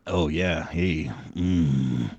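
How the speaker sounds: suddenly loud and close; back close to mic. Real, not acted. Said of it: "'cause you're far away from your mic".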